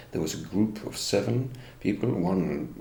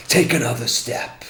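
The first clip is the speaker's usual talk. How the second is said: slow, louder stern order; insistent command; 5 semitones higher, 8 dB louder than usual. Retold or imitated. Officer insisting on the step back, slow louder stern order. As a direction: drag the command out firmly.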